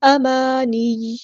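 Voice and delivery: امانی with its vowels stretched for several counts; long held madd. Shown as a demonstration. Teacher shows the elongation, slow held امانی. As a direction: recite امانی slowly, holding the madd. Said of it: "ہم نے اس کو four five count لمبا کرنا ہے".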